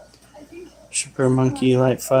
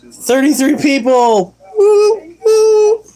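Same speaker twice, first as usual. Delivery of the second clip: high whooping "Woo, woo!"; excited cheer; 12 semitones higher, slower than usual. Real, not acted. Cheering viewer count.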